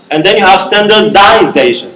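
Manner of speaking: usual speaking voice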